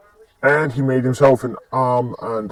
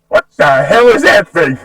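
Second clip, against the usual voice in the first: loud, higher-pitched alarmed outburst; startled yell; 6 semitones higher, 9 dB louder than usual. Acted. Startled at a two-headed T-Rex, a loud alarmed yell.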